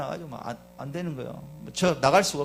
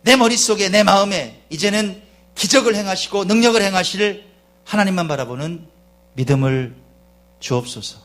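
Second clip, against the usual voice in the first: loud, emphatic plea to God, settling at the end; forceful prayer in measured phrases; fervent pulpit prayer; 6 semitones higher, 10 dB louder than usual. Real, not acted. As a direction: pray aloud with force, easing down.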